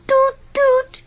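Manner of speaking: high sung toot like a train whistle. A narrator's reading. Train whistle sound in story.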